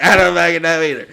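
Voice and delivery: loud, high comic outburst; mock exclamation. Comedian answering a woman at the table, loud baffled outburst. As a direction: yell it in baffled disbelief.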